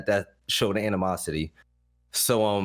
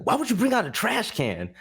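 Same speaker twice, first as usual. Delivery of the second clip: exasperated scolding; 11 semitones higher than usual. Retold or imitated. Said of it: "He's like".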